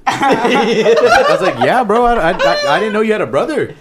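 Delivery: high, laughing through the words; can barely get it out for laughing. Real, not acted. Telling friends about the surprise twin, cracking up. Laughing while retelling awkward meeting.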